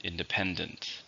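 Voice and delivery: "Independent" with the stress on PEN; stress on third syllable. Shown as a demonstration. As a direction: softly model "Independent", leaning on PEN. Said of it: "stresses on the third syllable".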